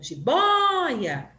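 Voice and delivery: "jiboia" slowed, with a long high "bói"; stressed vowel demo. Shown as a demonstration. Teacher quizzing which vowel is strong, exaggerated stress on "jiboia". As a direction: stretch the Ó of "jiboia", let the last A drop. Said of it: "Quem que eu falei forte?".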